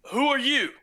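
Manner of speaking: loud, high call from the tower; guard's challenge. Acted. Tower guard calling down to the travelers, loud and high. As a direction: challenge the strangers from above. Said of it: "he goes".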